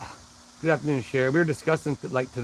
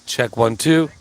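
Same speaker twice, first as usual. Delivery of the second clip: calling out a sound check; loud mic check; 3 semitones lower, 8 dB louder, slower than usual. Shown as a demonstration. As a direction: test the mic, loud and firm. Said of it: "I'm gonna turn this up real loud".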